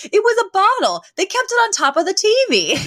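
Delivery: Sylvia, defensive. Retold or imitated. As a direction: imitate an indignant mother defending herself. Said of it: "she gets so defensive".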